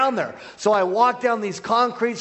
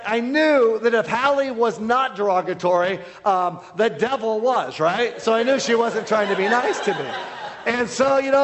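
ordinary talk

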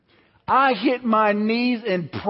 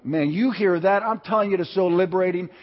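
ordinary talk, nothing marked

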